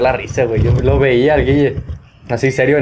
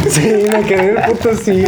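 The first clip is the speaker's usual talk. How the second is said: laughing; 6 semitones higher than usual. Real, not acted. Laughing at own embarrassing story.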